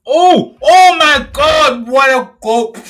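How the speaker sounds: high, loud drawn-out scream of joy; goal celebration. Real, not acted. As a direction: erupt as the ball hits the net. Fan reacting to a goal.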